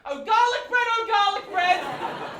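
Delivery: high, loud swooning ode; passionate cry. Acted. Poet declaring love to garlic bread, hammed-up passionate cry. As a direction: cry out to it like a lost lover.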